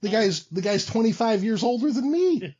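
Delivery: high-pitched, incredulous complaint; comic protest. Real, not acted. Champion objecting to his much older challenger, comic protest. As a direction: protest with the voice climbing, stumbling into it.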